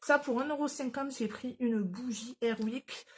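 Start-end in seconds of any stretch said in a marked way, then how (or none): none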